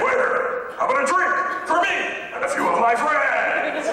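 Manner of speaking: high-pitched mock Chinese accent calling a waiter. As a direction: order like a badly faked Chinese movie character. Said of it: "thinking you're all Chinese".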